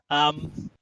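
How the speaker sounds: ordinary talk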